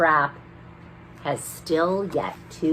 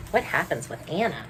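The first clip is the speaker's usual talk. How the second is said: usual speaking voice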